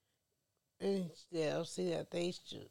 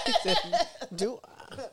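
laughing; 8 semitones higher, 12 dB louder than usual. Real, not acted. Laughing at daughter's reply.

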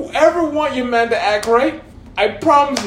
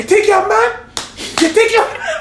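mocking taunt; 10 semitones higher than usual. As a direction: dare him to act grown. Mother mocking son's defiance.

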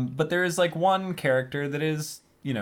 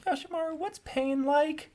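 little Gaara asking; 5 dB quieter than usual. Acted. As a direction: ask it like a small boy who never got hurt. Voicing four-year-old Gaara asking uncle.

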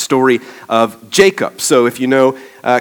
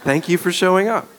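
higher, sincere-sounding thanks; sincere redo; 8 semitones higher than usual. Real, not acted. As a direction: say the thanks over, pitched up to undo any sarcasm. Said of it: "Did I sound sarcastic?".

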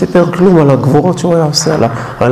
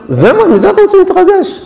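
incredulous scorn; 12 semitones higher than usual. Real, not acted. Mocking sports excitement.

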